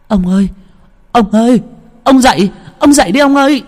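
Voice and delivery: high, loud calls to wake a husband; urgent calling. Acted. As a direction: shake him awake and shout his name. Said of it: "vừa lay, vừa gọi, gọi chồng". (gọi chồng dậy, giọng cao và to)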